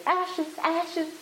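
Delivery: high-pitched little bird cry; sparrow call. A narrator's reading. Sparrows advising the struggling girl, in high bird calls. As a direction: chirp the words high like a sparrow.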